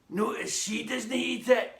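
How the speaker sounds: lightly voiced croaking granny; croaky granny. A narrator's reading. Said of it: "she croaked".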